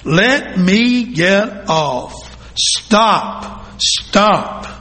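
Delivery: slow, drawn-out plea to stop; a dizzy teacup begging to get off the wheel; mild mock scream. A narrator's reading. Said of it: "I screamed".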